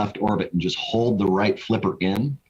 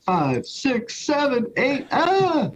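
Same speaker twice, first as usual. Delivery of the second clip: counting up, climbing higher into a yelp; excited counting; much slower than usual. Retold or imitated. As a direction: count the loops, more thrilled each number. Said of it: "You're like".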